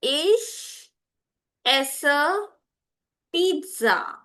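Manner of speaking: word by word, pausing between "Ich", "esse" and "Pizza"; slow for repeating. Shown as a demonstration. Teacher models a sentence to repeat after her, slowly. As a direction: leave room after each word for learners to echo. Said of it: "I'll speak slowly".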